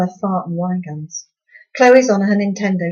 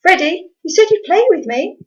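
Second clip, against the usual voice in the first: put-on much higher, louder little girl's plea; child pleading; 11 semitones higher, 6 dB louder than usual. A narrator's reading. Little sister to big brother in car park, a high pleading whine.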